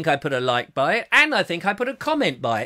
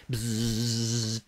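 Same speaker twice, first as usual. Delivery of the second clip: beam-up buzz noise; 6 semitones lower, 6 dB quieter than usual. Pretend. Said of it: "beam me up".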